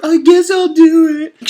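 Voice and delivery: high, loud, slow resigned whine; high, slow, mopey girl giving in. Acted. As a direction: drag it out, defeated. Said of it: "she gets all depresso".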